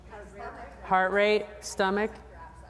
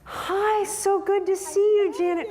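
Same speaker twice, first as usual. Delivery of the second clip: high, sugary fake-nice greeting; high mock-sweet hello to a rival; fake friendly greeting; 11 semitones higher, 4 dB louder than usual. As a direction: gush over her like a frenemy. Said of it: "So we can be like this, like".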